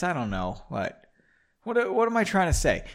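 ordinary delivery

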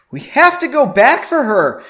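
urgent, high, loud plea; pleading. A narrator's reading. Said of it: "she pleaded with Stephen".

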